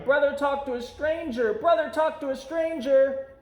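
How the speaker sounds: high, loud tattling shout. A narrator's reading. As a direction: call it out across the yard like a tattling kid. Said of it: "Sister ran home shouting".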